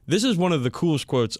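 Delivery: ordinary talk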